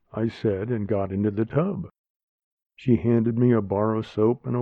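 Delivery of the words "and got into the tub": ordinary delivery, nothing marked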